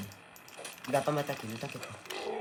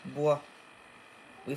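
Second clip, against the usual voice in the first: normal talking voice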